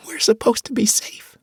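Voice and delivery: put-on high, whimpering girl. Tasha whimpering to the adults, high and hurt. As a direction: whine it through fear. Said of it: "Tasha whimpered".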